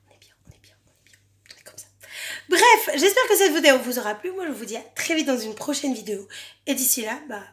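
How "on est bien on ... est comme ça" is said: whisper. Real, not acted. Settling down calmly.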